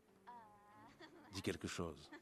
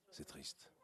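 sad near-whisper; whispered sadness; 2 semitones lower, 9 dB quieter than usual. Acted. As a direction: breathe it out, unvoiced and sad. Last days, thinking of family.

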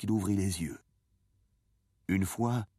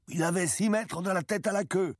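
much higher, excited fisherman's cry; shouted announcement; 13 semitones higher, 4 dB louder than usual. A narrator's reading. Fisherman who measured the skeleton tells the boy, shouting. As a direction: call out the measurement proudly, pitched high. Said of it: "cria le pêcheur qui avait mesuré".

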